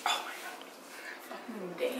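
whispered, drawn out in disgust; breathless whisper. Real, not acted. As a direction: barely breathe it out. After swallowing the disgusting drink.

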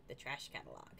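usual speaking voice

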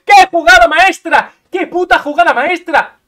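loud, high, laughing shout of triumph; ecstatic yell. Real, not acted. Gamer screams in delight after a crazy move. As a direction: explode with joy at the play. Celebrating a huge play.

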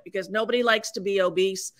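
normal talking voice

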